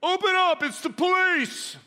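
very high, loud yell; screamed police raid. Acted. Kid playing cop outside the bedroom door, screamed. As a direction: scream it as loud as you can. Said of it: "scream as loud as they can".

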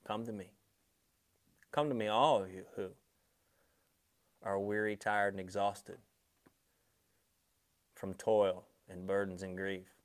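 soft, gentle calling; a soft voice of Jesus calling; tender invitation. Acted. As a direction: invite them quietly in. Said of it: "He says".

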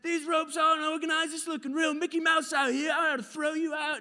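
loud, high scolding rant; Uncle Jim yelling. Retold or imitated. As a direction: chew out the crew at full volume. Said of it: "he's, like, yelling at me".

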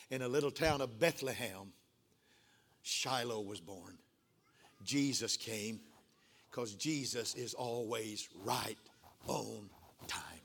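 slow, low, soft sermon delivery; hushed preaching. Acted. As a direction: let each phrase fall away reverently, with pauses. Preacher drops to hushed solemn emphasis.

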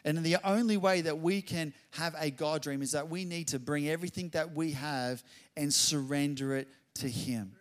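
ordinary delivery

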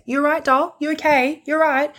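high, sugary mock saleswoman; pushy saleswoman. Imitating the sales lady checking in on the bride. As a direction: coo it way up high like a pushy seller. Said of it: "Every two minutes".

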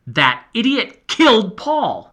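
loud, high, slow accusation; angry accusation. Acted. Boy accusing the narrator, loud and angry. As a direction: lean hard on killed. Heated quarrel.

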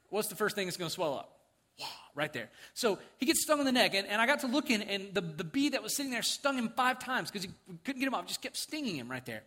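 usual speaking voice

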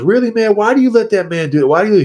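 high, disbelieving questions; incredulous fellow prisoner. Retold or imitated. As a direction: ask why he puts up with it, pitched high.

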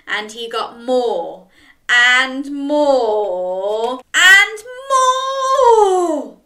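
growing from plain to high and loud, almost sung; building excitement. A narrator's reading. Narration escalating more and more.

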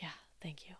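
breathy whisper of thanks. Retold or imitated. Whispering sheepish thanks to the kind doctor. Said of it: "And I was like".

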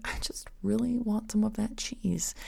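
slow, breathy whisper of longing; whispered craving. Retold or imitated. Host imitating a dieter secretly craving cheese, whispered. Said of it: "you reach a point where you're like".

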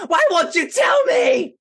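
high, whiny yell; impatient shout. Acted. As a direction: demand it at full volume.

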